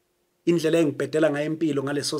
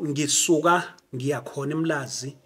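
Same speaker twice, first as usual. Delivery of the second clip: ordinary talk, nothing marked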